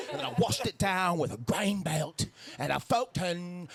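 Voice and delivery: slow, drawn-out rock frontman; slow rock frontman crowd work. Retold or imitated. [name] onstage impression.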